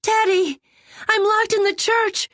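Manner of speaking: very high, loud frightened shriek; trapped girl shrieking to Teddy; terrified shriek. A narrator's reading. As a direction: scream for help in panic. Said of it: "she shrieked".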